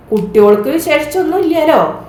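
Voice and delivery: pitched high, calling a question across. A narrator's reading. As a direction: ask as if to someone hard of hearing. Deaf old uncle asks loudly. (ഉയർന്ന സ്വരത്തിൽ ചോദ്യം)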